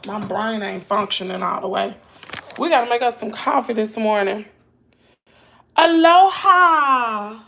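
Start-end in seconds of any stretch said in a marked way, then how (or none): 5.8-7.4 drawn-out, loud, high Aloha; excited greeting. Real, not acted. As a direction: sing out a big slow hello to viewers. Greeting viewers joining live.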